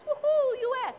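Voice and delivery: high, whooping mini celebration; playful cheer. Pretend. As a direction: whoop it up for the home team. Cheers for U S Virgin Islands.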